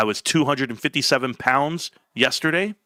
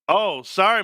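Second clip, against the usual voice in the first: ordinary talk, nothing marked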